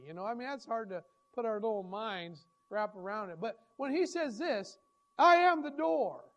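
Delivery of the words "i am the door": higher and louder, slowed right down; loud slow proclamation. Acted. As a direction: declare it from the pulpit. Preacher proclaims Jesus' words.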